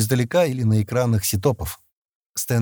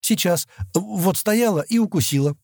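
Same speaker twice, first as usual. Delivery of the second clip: put-on high, lightly plaintive girl's voice; complaining; 9 semitones higher than usual. A narrator's reading. Girl complains to Стэн about a bite, mildly plaintive. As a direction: complain a little, pitched up. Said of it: "пожаловалась Адана".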